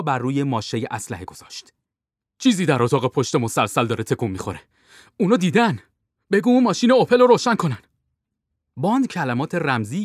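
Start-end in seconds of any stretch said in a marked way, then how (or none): 2.4-7.8 loud, raised urgent warning; urgent alarm. A narrator's reading. Captain warning Bond they spotted him, urgent alarm. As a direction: call out the danger, climbing to a shouted order.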